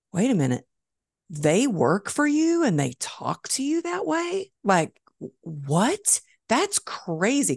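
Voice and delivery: high, incredulous shock at rude talk; shocked disbelief. Retold or imitated. Friend overhearing the call, stunned disbelief. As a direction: gasp out in disbelief.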